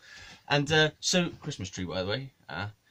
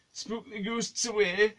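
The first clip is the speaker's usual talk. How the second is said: mock spooky voice; 10 semitones higher, slower than usual.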